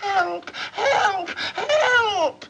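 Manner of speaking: hammed-up girl shrieking from the window; screaming for help. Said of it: "and she screams".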